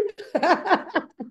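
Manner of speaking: bursts into a high laugh. Real, not acted. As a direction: cackles at her own story. Laughs at own story.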